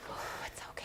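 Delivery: breathy, hushed reassurance; soothing whisper. Acted. Teacher to a child who threw his jacket, soothing whisper. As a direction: whisper comfort to an upset child.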